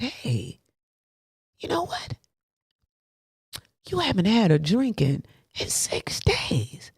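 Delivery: slow, hushed whisper of a tempter; the voice of temptation whispering softly; tempting inner voice. Retold or imitated. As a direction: whisper it like a sly voice in his head. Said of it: "the voice in his head said".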